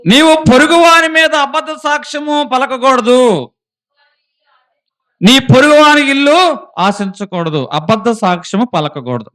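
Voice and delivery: loud, high, ringing Bible reading; scripture proclaimed. Acted. Preacher reading a proverb to the congregation, booming. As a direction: proclaim the verse to the whole church. Reads scripture at full force.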